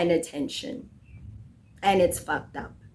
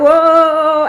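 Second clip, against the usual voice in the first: sung-out mock whoa, higher and louder; mock Ryan whoa; 6 semitones higher, 8 dB louder than usual. Ryan hyped while a girl twerks on him, mock whoa. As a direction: belt a playful whoa. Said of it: "He's like".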